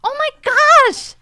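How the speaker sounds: high, loud exasperated plea; exasperated mom. Retold or imitated. Mother pleading with her son in paradise, loud and exasperated. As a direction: beg the kid off his phone, incredulous. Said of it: "I'm like".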